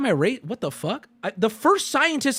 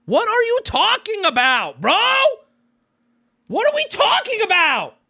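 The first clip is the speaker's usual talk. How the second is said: exasperated rant; 11 semitones higher than usual. Real, not acted. Ranting at a comment.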